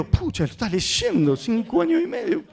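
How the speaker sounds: astonished aside, rising high at the end; incredulous inner voice; amazed thought. Retold or imitated. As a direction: marvel to yourself, pitch climbing. His amazed thought at reading child.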